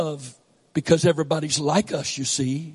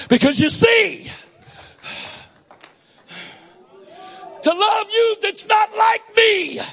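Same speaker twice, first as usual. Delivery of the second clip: full-force preaching; 10 semitones higher than usual. Acted. Preacher defending his Southern accent, impassioned preaching. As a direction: cry it out to the congregation.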